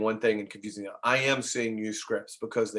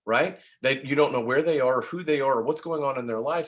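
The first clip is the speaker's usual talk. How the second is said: usual speaking voice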